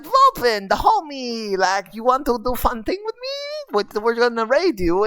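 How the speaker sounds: high squeaky goblin, eager and friendly. Retold or imitated. Goblin asking a friend to raid, squeaky and high. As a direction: squeak it out like a cheerful little goblin. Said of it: "I used all of the squeaky sounds".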